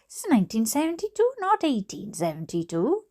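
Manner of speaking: high, rapid indignant protest. A narrator's reading. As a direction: swoop up high as the affronted mother. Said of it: "said my mother in a high tone".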